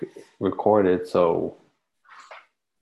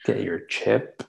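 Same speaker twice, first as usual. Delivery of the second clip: whispered, unvoiced and secretive; conspiratorial whisper; sinister whisper; slower than usual. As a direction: breathe it like a dark secret. Mock sinister aside.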